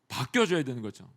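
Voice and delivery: ordinary talk, nothing marked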